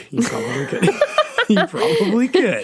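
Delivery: words breaking up into high laughter; helpless giggling through the words; laughing. Real, not acted. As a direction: crack up while conceding the point. Cracking up at carrot pumpkin idea.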